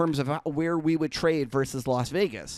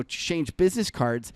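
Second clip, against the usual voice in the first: ordinary delivery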